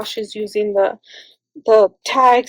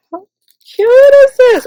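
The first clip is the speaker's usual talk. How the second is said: overwhelmed with delight at a gift; squealing delight; 13 semitones higher, 8 dB louder than usual. Real, not acted. Crafter opening a gift box, squealing with delight.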